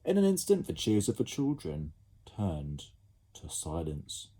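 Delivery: ordinary delivery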